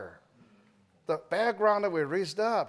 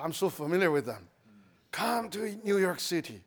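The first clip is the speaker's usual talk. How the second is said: ordinary talk, nothing marked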